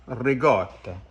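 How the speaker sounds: "ricotta" corrected to rih-GAW-tuh; Italian-American pronunciation. Shown as a demonstration. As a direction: say "ricotta" with g, not k. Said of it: "no ricotta".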